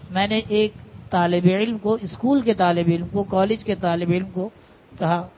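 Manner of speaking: usual speaking voice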